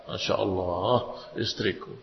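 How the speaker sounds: soft, low fond murmur; tender admiration. Retold or imitated. Husband watching his wife read the Quran, tender and soft. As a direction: admire her quietly and lovingly.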